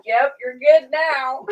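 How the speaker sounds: laughing. Real, not acted. Laughing while retelling funny moment.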